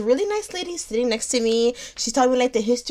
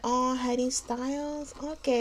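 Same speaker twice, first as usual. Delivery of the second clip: put-on Swedish man's accent; 3 semitones higher, 9 dB quieter, slower than usual. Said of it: "he's like".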